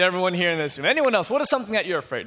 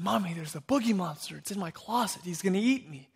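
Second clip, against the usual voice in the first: put-on hushed, softer little-kid voice; scared child confiding; 2 semitones higher, 5 dB quieter than usual. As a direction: confide it quietly, as if the monster might hear. Scared child confides monster in closet.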